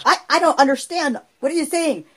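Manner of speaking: puzzled mother asking; mom's voice. Retold or imitated. Mom voiced in story.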